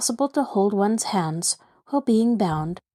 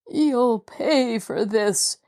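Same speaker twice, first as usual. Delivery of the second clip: put-on slow, lightly quavering old voice; a hint of a trembling old man, slow; 3 semitones higher, much slower than usual. A narrator's reading. Old man threatening his captor, slow and quavering. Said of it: "Jacob Aborn cried in a quavering voice".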